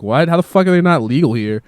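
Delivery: loud, high retold outburst of disbelief; outraged disbelief. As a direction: yell it as if you just found out the cars were banned. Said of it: "That shit made me mad".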